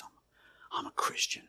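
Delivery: halting, whispered admission; hesitant whisper. Retold or imitated. Man on the bridge answering a stranger's question, whispered and hesitant. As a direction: answer timidly under your breath.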